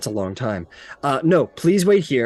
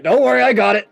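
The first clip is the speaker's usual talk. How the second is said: higher, louder, overconfident; cocky; 7 semitones higher, 5 dB louder than usual. Acted. Assistant mid-yank boasting to patient, higher and louder. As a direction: brush it off with bravado. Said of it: "he's like".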